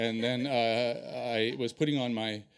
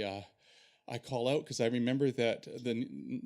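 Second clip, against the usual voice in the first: ordinary talk, nothing marked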